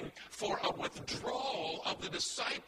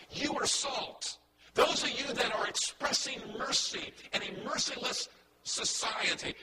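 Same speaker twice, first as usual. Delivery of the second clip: ordinary talk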